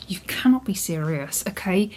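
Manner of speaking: ordinary talk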